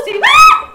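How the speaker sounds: very high loud shrieked "ah"; mock shriek. Director mimics women screaming at a mouse, high laughing shriek. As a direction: shriek like a woman seeing a mouse. Imitating a woman seeing a mouse.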